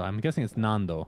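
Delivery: normal talking voice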